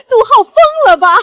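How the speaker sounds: high, loud, quick jeer; incredulous scoff. Acted. Wife on seeing rival plant mangoes, incredulous scoff. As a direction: blurt it in disbelief, about to laugh.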